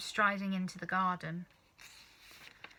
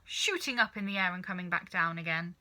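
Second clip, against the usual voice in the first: ordinary delivery, nothing marked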